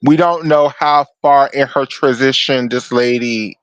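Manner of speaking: ordinary delivery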